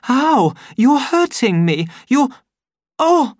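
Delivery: high, loud yelps of pain; pained cry. A narrator's reading. Lenina crying out as the savage pushes her. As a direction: cry out as he grips you.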